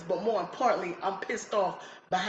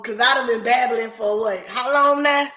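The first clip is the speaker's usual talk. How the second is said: ordinary delivery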